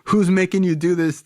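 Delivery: high-pitched imitation of an alarmed father; questioning dad. Dad asking his son crazy questions, high and urgent. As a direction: interrogate like a dad demanding answers. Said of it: "all these crazy questions".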